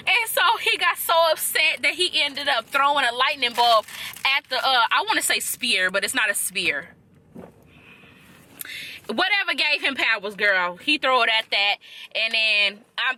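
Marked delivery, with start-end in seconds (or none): none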